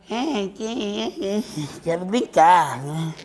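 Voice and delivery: mock small child asking to play; child's whine. Child begging father to play with the iPhone, mock whine. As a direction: whine like a little kid who wants the phone. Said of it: "Aí a criancinha chega lá e".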